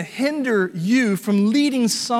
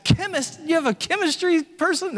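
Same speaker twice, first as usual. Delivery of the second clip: high-pitched baffled echo; mock incredulity, pitched way up; puzzled disbelief; 7 semitones higher than usual. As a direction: act out a bewildered tourist's question. Retells confusion at unfamiliar word.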